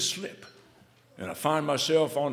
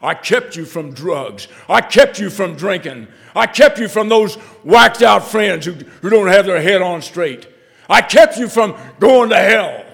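louder and louder with each line; building fatherly rebuke; 7 semitones higher, 17 dB louder than usual. Retold or imitated. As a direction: hammer every line home harder.

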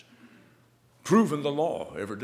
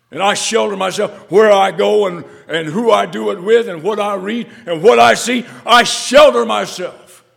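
loud, higher preaching, slowing at the end; full-force preaching; 8 semitones higher, 16 dB louder than usual. Acted. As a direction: preach it out to the congregation.

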